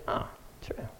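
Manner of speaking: breathy whisper, almost no voice; whispered aside. Retold or imitated. Retold chat with stranger.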